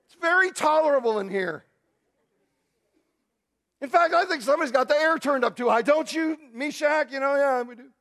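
high, loud, cheeky mock nonchalance; men in the fire joking back at the king; playful pretend reply. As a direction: tease the king like it's a cool room. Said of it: "I would have loved if one of them said".

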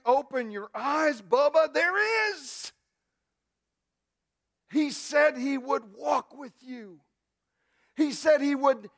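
high, loud, slow fervent reassurance; preacher answering the whiner; preaching at full force. Acted. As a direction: proclaim it to the lonely sufferer.

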